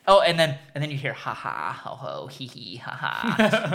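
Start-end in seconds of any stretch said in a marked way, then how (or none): 1.1-3.7 low, soft spoken laugh syllables; mock Joker laugh. Describing the Joker's entrance, soft mock laugh. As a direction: toss off a sly villain's chuckle.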